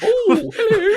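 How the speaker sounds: put-on very high, squeaky old-person hello; delighted old person. Old people answering his howdy, high and delighted. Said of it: "they're like".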